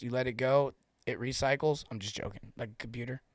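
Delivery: ordinary talk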